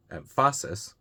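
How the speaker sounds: em-FA-sis, middle syllable of emphasis punched; stress on second syllable. Shown as a demonstration. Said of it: "the second one".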